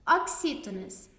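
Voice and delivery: normal talking voice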